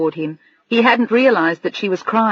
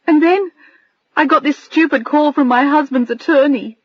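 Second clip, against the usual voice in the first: higher, voice trembling with tears; 7 semitones higher than usual. Acted. Adrian confessing the husband's call to Bill, trembling. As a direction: let the voice quiver. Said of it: "her voice trembled as she said it".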